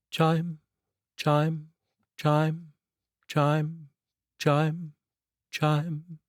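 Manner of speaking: clock chimes. A narrator's reading. Narrator imitating the grandfather clock, lightly sung chimes. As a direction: ring out each chime like a clock.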